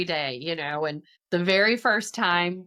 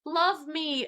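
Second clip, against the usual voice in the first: put-on baby voice; 8 semitones higher than usual. Voicing the eager baby.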